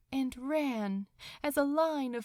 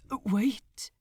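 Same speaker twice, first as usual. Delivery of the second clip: urgent call of Wait, louder; sudden call; 6 dB louder than usual. A narrator's reading. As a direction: stop them leaving with a cry. Dying man calls them back.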